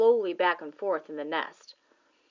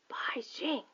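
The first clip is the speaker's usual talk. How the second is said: whispered softly; under his breath; 5 semitones higher, 9 dB quieter than usual. A narrator's reading. Father whispering as he stares into the nest. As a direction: breathe it out in awe. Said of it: "under his breath".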